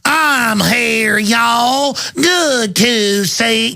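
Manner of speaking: exaggerated Kentucky drawl, very slow; mock Kentucky accent. Host imitates Hillary visiting Kentucky, mock Kentucky accent. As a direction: stretch every word out in a hammed-up Kentucky twang. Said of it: "Hillary talks with Kentucky accent".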